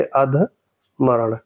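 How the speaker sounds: अधमरण drawn out very slowly; teacher stretching अधमरण; slow emphasis. Shown as a demonstration. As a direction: plant अधमरण slowly in their memory. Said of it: "ये ध्यान रखेंगे".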